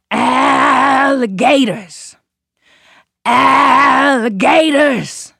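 slow, loud, drawn-out call across the swamp. A narrator's reading. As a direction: bellow it out to the whole swamp. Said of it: "the gator called out".

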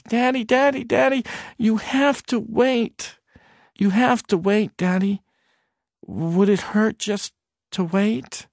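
put-on high little boy voice; pleading child. Son asking his father to wait, high little boy voice. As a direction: coax dad to wait like a small boy.

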